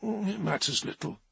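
soft, faint, high and tired; weak and resigned. Acted. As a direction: let it out weakly, with a shrug. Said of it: "shrugging weakly".

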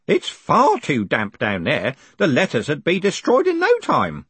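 a hint of a concerned old underling; old postal worker, protesting. Grote warns about damp in the basements, lightly voiced protest.

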